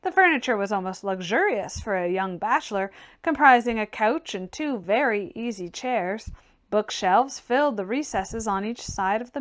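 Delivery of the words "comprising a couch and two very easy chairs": ordinary delivery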